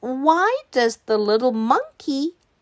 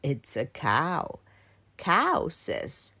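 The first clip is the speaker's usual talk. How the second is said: low, quieter grandfather voice; Grandpa explaining; 8 semitones lower, 5 dB quieter, slower than usual. Acted. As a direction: answer low, teaching a grandchild. Grandpa answers child in story.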